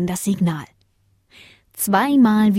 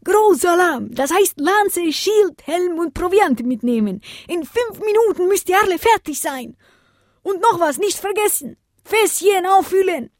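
much higher commanding shout; 12 semitones higher than usual. Acted. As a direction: give orders to the troops. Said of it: "befehligte Antonio".

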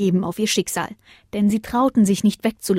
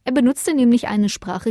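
ordinary delivery, nothing marked

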